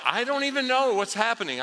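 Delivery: high, loud protest of confusion; mock fluster. Preacher defending himself to his brother, mock fluster. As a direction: protest loudly, comically out of your depth.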